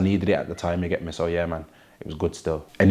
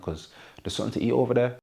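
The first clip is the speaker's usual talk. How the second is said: ordinary delivery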